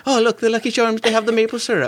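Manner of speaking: very high, silly Irish-Canadian lilt; mock Irish-Canadian accent. Spotting Canadian Lucky Charms, mock Irish-Canadian accent. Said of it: "Irish Canadian".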